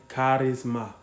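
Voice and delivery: Charisma in a mock foreign accent, rolled r and open a; put-on foreign accent. Shown as a demonstration. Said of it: "in a different accent for your ass".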